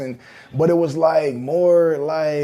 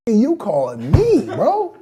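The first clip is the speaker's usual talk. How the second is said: incredulous; 8 semitones higher, 5 dB louder, slower than usual. Retold or imitated. Indignant at being put on hold.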